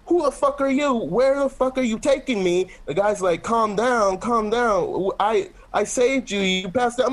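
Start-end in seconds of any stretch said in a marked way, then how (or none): 0.1-2.7 frantic high mock scream; mock screaming panic. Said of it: "Mario starts screaming". 3.4-7.1 put-on higher-pitched Italian accent, placating; acted Italian-accented pleading. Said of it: "too deep into the Italian accent".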